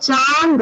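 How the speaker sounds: चांद stretched on the nasal आं; long sound. Shown as a demonstration. Teacher giving another long-sound example, चांद drawn out. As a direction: hold the आं in चांद long before the द. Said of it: "long sound आता है".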